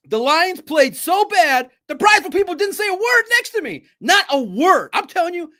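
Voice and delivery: loud, high excited rant; worked up and loud. Real, not acted. Excited rant about silent fans.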